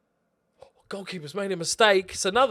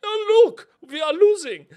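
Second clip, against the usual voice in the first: put-on high, louder camp German accent, vee for we; a hammed-up German fan noticing the loss; 10 dB louder than usual. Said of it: "I can only do camp German".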